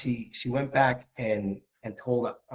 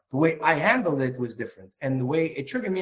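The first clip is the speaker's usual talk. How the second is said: ordinary talk, nothing marked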